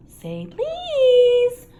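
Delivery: very high, slow taunt; teasing poltergeist. A narrator's reading. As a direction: make him beg. Peeves voice.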